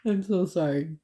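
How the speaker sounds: words squeezed out through high giggles; laughing. Real, not acted. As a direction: talk while cracking up. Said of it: "I'm laughing with them".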